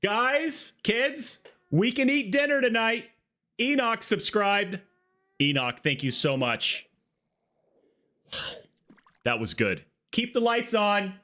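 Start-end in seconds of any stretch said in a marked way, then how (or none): 0.0-4.8 high-pitched hammed-up joy; mock-overjoyed parent. Parent telling the kids they can eat, mock-overjoyed. 10.1-11.1 high-pitched hammed-up joy; mock-overjoyed parent. Parent telling the kids they can eat, mock-overjoyed. Same overjoyed bit continues.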